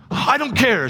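high-pitched mock tantrum retort; defiant child outburst. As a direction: imitate a daughter protesting her punishment. Acted daughter defying her punishment.

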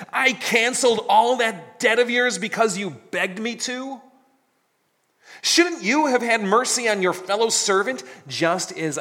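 angry master. Acted. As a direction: thunder it at the wicked servant. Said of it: "And in his anger".

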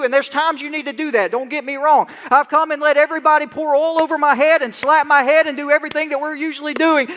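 normal talking voice

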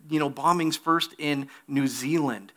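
normal talking voice

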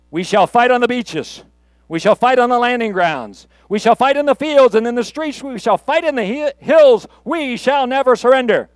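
put-on rally the troops with defiant resolve, own accent; Churchill. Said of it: "you ready for a boast?".

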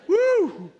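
high loud woo after a lift; triumphant whoop. Retold or imitated. Gym lifter whooping after the lift to impress his wife. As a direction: let out a big victory whoop.

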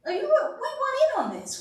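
high, quick excited squeal; squealing eager. Acted. To the congregation about wanting a taste, squealing excitedly. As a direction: pitch way up and rush it, eager to join.

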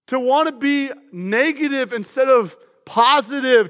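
normal talking voice

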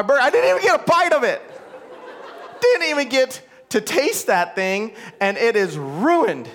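worked-up, hammed-up exasperation; exasperated retelling. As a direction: wail in comic disbelief over a ruined meal. Reliving his ruined burger, exasperated.

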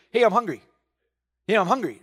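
hungry body nagging; body's voice. Retold or imitated. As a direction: nag like a hungry stomach. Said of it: "my body has its own voice too".

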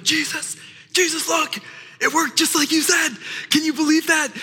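high, thrilled, bursting with news; excited disciples. Acted. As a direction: rush back to Jesus full of excitement. Excited disciples report back.

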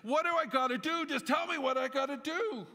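high, loud, exasperated yelling; retold outburst at the pastor, yelling high and loud; angry shout. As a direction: shout it at him in frustration. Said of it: "I started to shout at him".

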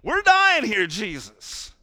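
panicked disciples. Retold or imitated. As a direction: ham up frightened sailors crying out. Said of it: "they're like".

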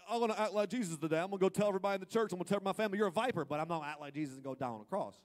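loud and fast, smug zealot; mock self-righteous zealot. Believer bragging about scolding family, loud and fast. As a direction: gloat over telling everyone off. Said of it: "you know".

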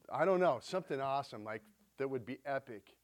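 ordinary talk, nothing marked

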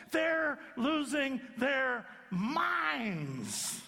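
high, slow, shouted accusations; frantic blaming shout. Retold or imitated. Husband and wife screaming at each other over the lost son.

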